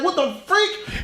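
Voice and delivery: mock-shocked high squeak; squeaky disbelief. Young Flash startled by his double, high-pitched.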